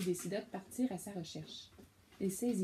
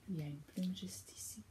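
whisper; 8 dB quieter than usual. Real, not acted. Parent shows the child the feather, whispering. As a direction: breathe it like a secret. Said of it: "pointing out a hidden feather".

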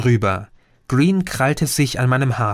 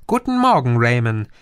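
cheerful greeting. Acted. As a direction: sing it out far too cheerfully at dawn. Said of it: "ein fröhliches". (fröhlich hoch gesungenes Guten Morgen)

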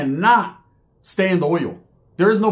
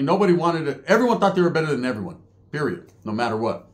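normal talking voice

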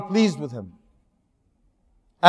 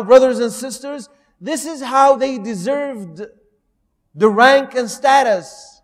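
ordinary talk, nothing marked

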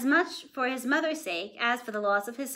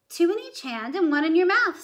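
sharp as a needle; 6 semitones higher, 6 dB louder than usual. A narrator's reading. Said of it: "sharp as a needle".

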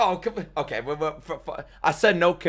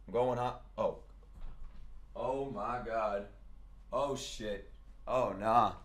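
dismayed; 4 semitones lower, 12 dB quieter, slower than usual. Real, not acted. Startled by next matchup.

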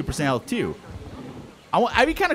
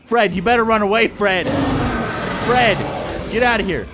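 louder urgent call; 4 semitones higher, 4 dB louder than usual. Real, not acted. Streamer shouting at baby raptor Fred to flee. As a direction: yell at him to run.

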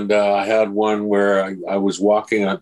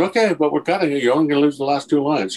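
breezy producers; 6 semitones higher, faster than usual. Retold or imitated. Producers overruling him.

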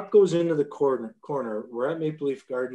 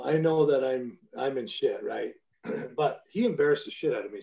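ordinary talk

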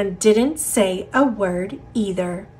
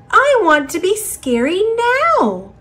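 eager little seed; 9 semitones higher, 3 dB louder than usual. Acted. Seed insists.

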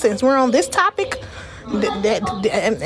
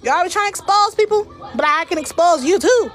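much higher, pointed warning; high taunting threat; 9 semitones higher than usual. Real, not acted. Streamer warns commenters she can expose them too, high and taunting.

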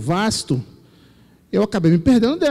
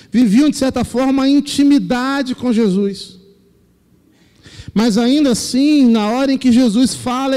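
pregando com força, voz mais alta e mais intensa; pregação inflamada; 2 semitones higher, 3 dB louder than usual. Acted. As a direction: pregue com fervor ao povo da igreja. Preaching to the congregation. (pastor prega à congregação com fervor)

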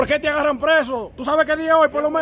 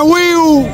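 mock firework whistle; 4 semitones higher, 7 dB louder than usual.

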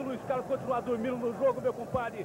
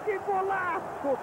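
excited exclamation; 7 semitones higher, slower than usual. Real, not acted. Repeated exclamation higher.

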